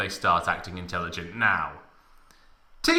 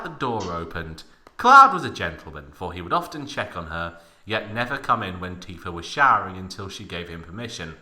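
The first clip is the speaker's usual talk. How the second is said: usual speaking voice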